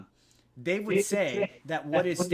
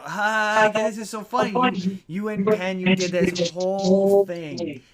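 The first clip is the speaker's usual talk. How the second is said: retold giddy laughter, pitched up and louder; gleeful laughing Teller; 5 semitones higher, 7 dB louder, slower than usual. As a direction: imitate a man cracking up with delight. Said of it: "and he's like".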